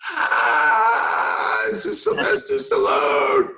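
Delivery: high, loud fanboy shriek; re-enacting an overwhelmed fan's inner squeal; starstruck scream. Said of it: "I do it just like that".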